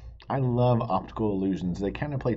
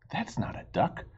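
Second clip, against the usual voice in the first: whisper; 5 semitones higher, 6 dB quieter than usual. Acted. Friends argue in story.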